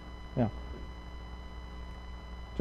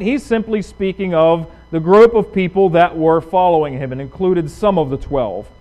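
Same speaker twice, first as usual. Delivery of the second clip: normal talking voice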